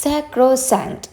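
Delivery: high, drawn-out "Sacrosanct", each syllable clear; pronunciation demo. Shown as a demonstration. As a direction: model "Sacrosanct" slowly for learners. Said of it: "എന്താണ്?".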